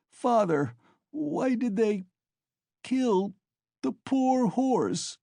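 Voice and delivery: high, drawn-out broken wail; sobbing child. A narrator's reading. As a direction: wail it in pieces, pitched very high. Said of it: "he sobbed, but his voice broke".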